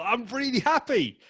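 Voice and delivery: very high, delighted I'm really happy; beaming; bursting with happiness. Shown as a demonstration. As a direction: glow with joy on happy. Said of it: "honor the word happiness".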